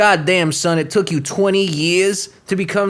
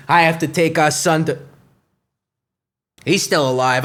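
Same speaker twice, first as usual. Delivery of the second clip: normal talking voice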